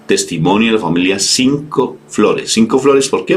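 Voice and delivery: usual speaking voice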